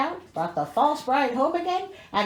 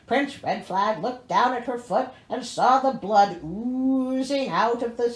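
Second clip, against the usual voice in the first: ordinary delivery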